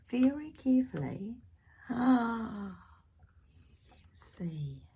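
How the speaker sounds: hushed, breathy reveal; whisper. Real, not acted. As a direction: whisper it like a secret. Suspense aside to child listeners.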